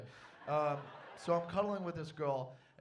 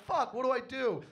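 panicked; 9 semitones higher, 9 dB louder than usual. Retold or imitated. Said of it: "I was just like".

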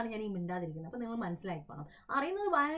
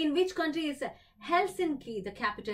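drawn-out quizmaster reading; slow, deliberate game-show question; slow quiz question read; 3 semitones higher, much slower than usual. Acted. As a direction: read the question slowly and clearly. Quiz host reads question aloud.

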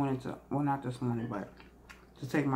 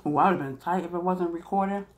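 ordinary talk

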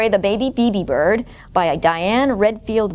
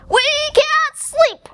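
high, slow exasperated shout; 4 dB louder, slower than usual. Acted. Animals kept awake by the bird, shouting. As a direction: stretch each word into a high cry. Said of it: "shouted all the animals".

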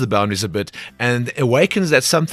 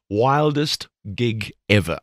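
slow, deliberate repeat; much slower than usual. Shown as a demonstration. Host repeating the interview question for effect, slow and drawn out. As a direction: draw out WILDEST GIG EVER at half speed.

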